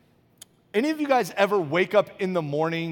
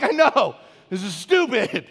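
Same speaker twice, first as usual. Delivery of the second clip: exasperated admission; 2 semitones higher, 7 dB louder than usual. Retold or imitated. Husband admitting to wife his anger is silly, loud mock exasperation.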